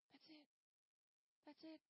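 high, hushed and light; soft, simple reassurance. Shown as a demonstration. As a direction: say it small, as if it's nothing. Shows how simple inviting is.

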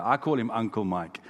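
ordinary delivery

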